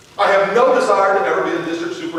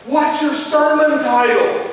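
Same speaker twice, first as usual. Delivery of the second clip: put-on higher, slow little boy question; persistent child; 6 semitones higher, slower than usual. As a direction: drag it out like a nosy kid. Son keeps questioning.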